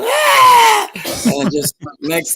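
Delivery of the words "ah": loud, high screaming goat imitation; sudden piercing high yell. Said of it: "'cause they scream and yell".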